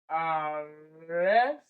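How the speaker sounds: "arrested" slowly, a-RRES-ted, RES long and rising; stress on second syllable. Shown as a demonstration. Teacher breaks down arrested, slow second-syllable stress. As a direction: stretch RES in "arrested", then drop TED. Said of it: "the second syllable is the one that is stressed".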